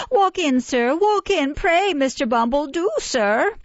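high, eager fawning woman; bow and scrape at the gate. A narrator's reading. Mrs Mann greets the beadle.

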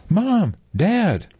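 high, loud happy shout; excited child. Acted. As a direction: squeal for her parents. Girl greets parents excitedly.

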